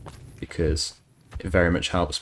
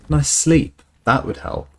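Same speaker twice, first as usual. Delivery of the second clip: excited; 8 semitones higher, 5 dB louder than usual. Real, not acted. Excited question.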